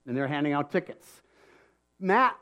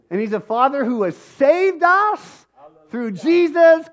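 loud, high, slow, ringing proclamation; preaching at full force; 6 semitones higher, 7 dB louder than usual. Acted. Pastor rousing the congregation about salvation, preaching loud. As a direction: lift the room on every phrase.

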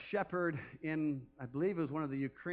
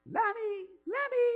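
very high sing-song call to the lambs; imitating the wife's high lamb call; 4 dB louder, slower than usual. Wife calling the lambs from the door, high sing-song. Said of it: "she would go".

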